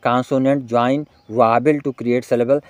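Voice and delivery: very slow, word by word; deliberate dictating read; slow dictation. Shown as a demonstration. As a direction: spell the question out slowly for students to copy. Dictating definition to students.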